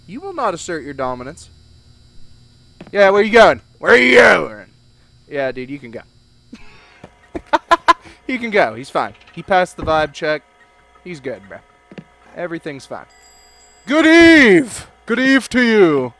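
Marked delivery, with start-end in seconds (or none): 2.9-3.6 loud call after someone leaving; loud clerk calling out; yelling after customer. Acted. 3.8-4.7 loud, insistent repeat; yelling after customer. Acted. 13.9-16.1 loud, high, hammed-up greeting; hammy loud greeting; mock posh greeting.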